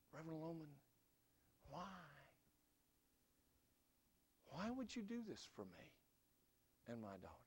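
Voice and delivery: a soft, far-off voice near tears after weeping; a man who has just wept, asking the preacher softly; choked-up plea. Retold or imitated. Father thanks the preacher after weeping, softly near tears. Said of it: "began to weep like a baby".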